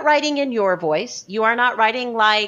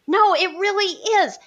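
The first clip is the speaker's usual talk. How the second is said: insistent, much higher protest; mildly worked-up insistence; 9 semitones higher than usual. Retold or imitated. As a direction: plead the case with heat. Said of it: "I would get all worked up".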